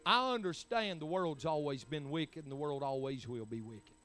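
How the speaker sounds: ordinary talk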